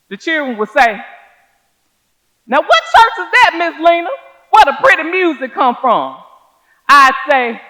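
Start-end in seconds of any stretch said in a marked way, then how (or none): 2.5-6.2 put-on much higher, louder, eager child asking; high excited kids' question; child's voice. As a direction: play the curious little ones. Children asking Miss Lena.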